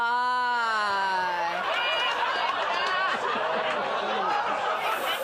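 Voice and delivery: long loud cackling laugh; loud cackle. Real, not acted. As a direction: cackle loudly at the punchline. Stand-up laughing at own joke.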